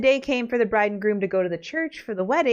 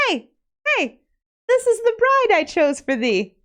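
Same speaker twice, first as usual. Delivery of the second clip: much higher and louder, bursting with pride; excited proud king; 10 semitones higher, 4 dB louder than usual. Acted. King boasting to his son about the bride, loud and high. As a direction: show off the bride at the top of your voice.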